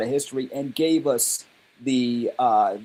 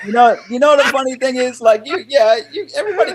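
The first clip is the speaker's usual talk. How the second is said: excited; 11 semitones higher, 7 dB louder than usual. Real, not acted. Animated reply, louder and higher.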